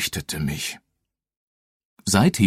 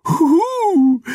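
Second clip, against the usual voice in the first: high, loud ghostly "huhu"; ghostly hoot; 5 dB louder than usual. Acted. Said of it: "sagte das Gespenst".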